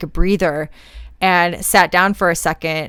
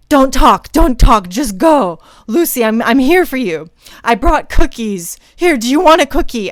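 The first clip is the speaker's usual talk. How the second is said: urgent coaching; 6 semitones higher, 6 dB louder than usual. Retold or imitated. Teammate urging exhausted runner on.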